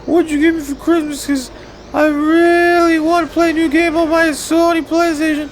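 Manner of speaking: very high, squeaky mock child; excited kid. Kid begging Mom for the Christmas game, squeaky kid voice. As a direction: put on an eager little kid's falsetto. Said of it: "the fucking kid for Christmas is like".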